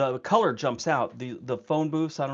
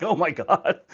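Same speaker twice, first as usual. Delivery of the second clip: louder laughing oh my God; laughing shock; 5 semitones higher, 7 dB louder than usual. Retold or imitated. At grandma's bike grudge, laughing shock. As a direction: gasp it through a laugh. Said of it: "I was like".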